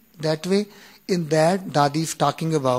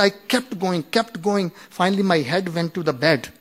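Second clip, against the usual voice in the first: usual speaking voice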